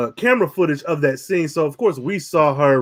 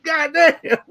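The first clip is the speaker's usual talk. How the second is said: mock surprise. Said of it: "I'm like".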